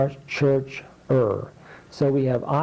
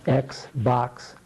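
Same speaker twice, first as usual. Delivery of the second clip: ordinary delivery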